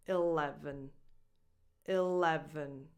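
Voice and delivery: eleven starting with the lax i of it; teacher model. Shown as a demonstration. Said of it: "lax I sound".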